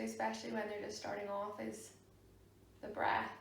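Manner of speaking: soft, unhurried meditation voice with long pauses; guided meditation. Real, not acted. Yoga teacher guiding a class to sit and breathe, soft meditation voice.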